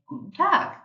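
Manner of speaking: "Guten Tag" modelled with a high rising "Tag"; teacher demo voice; German greeting. Shown as a demonstration. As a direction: lift the pitch on "Tag" as you model it. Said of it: "you would say".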